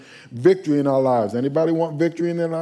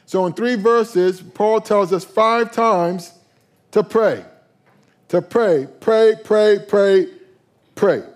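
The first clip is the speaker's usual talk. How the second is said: slow, rising, louder repetition of pray; emphatic preaching; 4 semitones higher, 4 dB louder, slower than usual. Real, not acted. Pastor driving home to the congregation to pray, pray, pray. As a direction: hammer pray, pray, pray home to the church.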